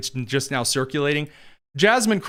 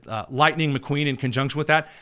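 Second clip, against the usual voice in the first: normal talking voice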